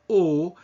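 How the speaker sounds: O with lips pushed fully forward; exaggeratedly pursed French O; closed O, lips protruded. Shown as a demonstration. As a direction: hold a pure rounded O without gliding. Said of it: "with your lips completely protruded".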